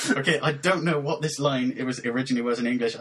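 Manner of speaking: usual speaking voice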